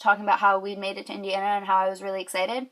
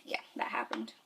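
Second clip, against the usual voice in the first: whisper; 11 semitones lower, 11 dB quieter than usual. Real, not acted. Embarrassed aside.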